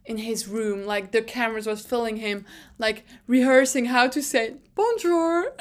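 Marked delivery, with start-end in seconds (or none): none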